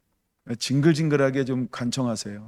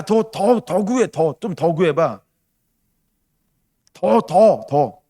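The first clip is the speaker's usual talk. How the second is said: high, loud pleading urge, slowing down; urging father voice; 5 semitones higher, 4 dB louder, slower than usual. Retold or imitated. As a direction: push the child to knock harder. Voicing God urging the believer. (아버지가 더 두드리라고 재촉하듯 높고 크게)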